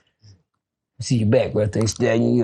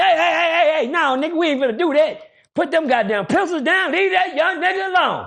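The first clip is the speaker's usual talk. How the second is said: commanding shout; 10 semitones higher than usual. Retold or imitated. Frankie Vine yelling at Mutt to drop the pencils. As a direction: bark them to put the pencils down.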